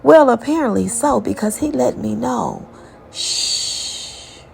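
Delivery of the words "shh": soft whispered shush; quick whispered shh; hushing shh. Retold or imitated. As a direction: hush someone urgently. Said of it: "be quiet".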